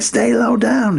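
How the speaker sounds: high-pitched imitation of a soldier's warning; urgent mock command; mock army warning. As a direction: call it like a soldier under fire, not full volume. Army guys calling out orders.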